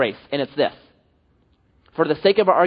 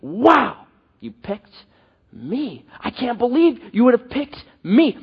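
much higher, excited disbelief, quickening on the team lines; astonished gratitude; 7 semitones higher, 4 dB louder than usual. Pretend. Last-picked believer thanks God, excited and incredulous. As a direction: gush in joyful disbelief at being picked. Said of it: "That's the idea".

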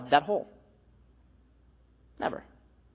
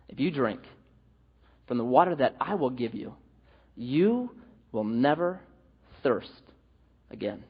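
softer, slowing into never thirst again; gentle promise; 6 semitones lower, 6 dB quieter than usual. Retold or imitated. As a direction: lean in and make the promise tender. Jesus gives his promise.

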